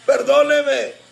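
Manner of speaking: desperate plea rising to a high cry; begging debtor. Acted. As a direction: beg harder with each plea.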